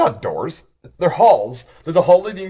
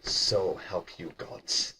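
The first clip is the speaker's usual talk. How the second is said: menacing chef; 5 dB quieter than usual. Acted.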